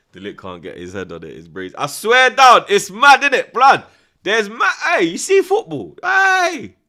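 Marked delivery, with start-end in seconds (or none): 1.8-6.8 much higher and louder, worked up; excited yelling. Real, not acted. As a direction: yell it in disbelief. Worked-up rant about football.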